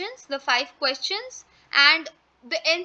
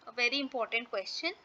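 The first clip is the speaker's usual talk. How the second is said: quiet aside; 2 semitones lower, 7 dB quieter, faster than usual. Shown as a demonstration.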